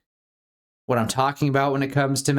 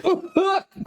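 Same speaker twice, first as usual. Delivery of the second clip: fake cough. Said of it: "Just kidding".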